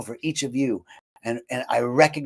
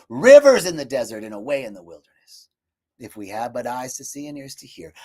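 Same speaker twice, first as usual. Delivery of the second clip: prayerful plea; 4 semitones higher than usual. Acted. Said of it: "I'm telling myself".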